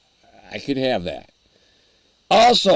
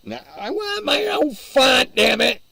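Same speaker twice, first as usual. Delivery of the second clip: mock demand; 10 semitones higher than usual. Mock demand about chat font.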